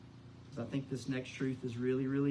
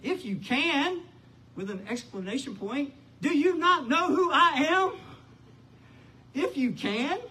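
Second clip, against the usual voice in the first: Jesus challenging; 11 semitones higher, 8 dB louder than usual. Acted. Jesus challenging the doubting father, loud. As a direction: throw his doubt back at him. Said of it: "Jesus says unto him".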